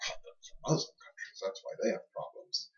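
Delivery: hushed, breath-only mock aside; imitating dismissive Christians. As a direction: mimic an excuse under your breath. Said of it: "I can hear Christians saying".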